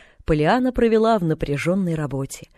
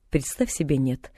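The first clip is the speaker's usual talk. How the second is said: dry, softer, curt reply; 4 semitones lower, 5 dB quieter than usual. A narrator's reading. Mrs Snow answering the girl, dryly. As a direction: brush it off coldly. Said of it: "сухо отозвалась миссис Сноу".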